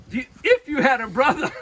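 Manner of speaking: loud, high, laughing delivery; struggling to tell it through laughter. Real, not acted. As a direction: crack up before reaching the punchline. Cracking up before punchline.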